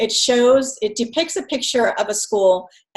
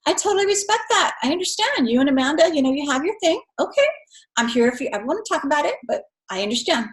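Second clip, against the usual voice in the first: reassuring parent; 5 semitones higher, faster than usual. Shown as a demonstration. Parent reassures a daughter who won't share her secret, gently and kindly. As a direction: ease the kid's mind, no pressure at all. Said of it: "as a parent, you need to say".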